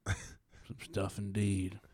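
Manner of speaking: low, quiet, grave voice; hushed storyteller. Pretend. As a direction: tell it hushed and haunted. Grim war-story voices.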